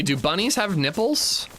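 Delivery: ordinary delivery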